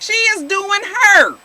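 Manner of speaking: heated, emphatic defence of a woman; angry telling-off. Real, not acted. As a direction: hammer the point home loudly. Rant aimed straight at Susie.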